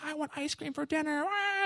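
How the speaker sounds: put-on high, soft childish whine; a parent mimicking a kid; whiny child. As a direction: whine like a kid wanting dessert. Kid throwing a fit.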